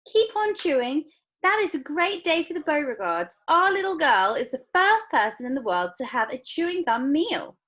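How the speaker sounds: loud, high boasting; proud father. A narrator's reading. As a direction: brag to everyone. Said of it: "said Mister Beauregard".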